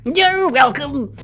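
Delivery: high, slow, smug clown voice; silly clown. Acted. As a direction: sing it out like a goofy clown. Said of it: "that's my clown voice".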